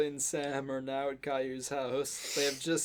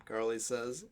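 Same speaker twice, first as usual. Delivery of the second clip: ordinary delivery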